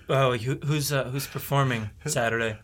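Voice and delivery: low, soft hesitant question; casual crew member. Acted. Cleaning crew member asking who performs Saturday, low casual murmur. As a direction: ask it low and offhand.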